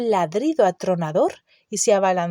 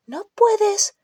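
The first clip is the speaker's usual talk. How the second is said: alarmed protest; 13 semitones higher than usual. A narrator's reading. Hermione protesting to Harry.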